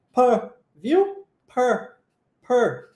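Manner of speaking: "Pur" with the U said as a short A sound; English vowel demo. Shown as a demonstration. Teacher drills "Pur" for students, showing the vowel. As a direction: repeat "Pur" deliberately, U turning to A. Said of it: "o U tem som de A".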